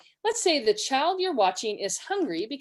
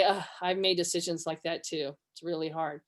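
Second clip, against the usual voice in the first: soft, low commiseration; empathetic; 5 semitones lower, 5 dB quieter than usual. Shown as a demonstration. Helper relating to someone who slipped up, gentle empathy. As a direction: quietly share in their difficulty. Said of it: "You can just say".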